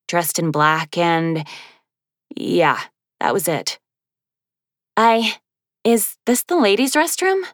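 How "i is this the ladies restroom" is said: high, halting flustered question; stammering. Acted. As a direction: stumble out the words, embarrassed. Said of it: "she stammered".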